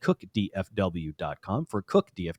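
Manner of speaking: measured announcer read with deliberate pauses; sponsor ad read. Acted. Host thanks roofing sponsor on air, ad read. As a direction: present the sponsor clearly, spacing out name and number.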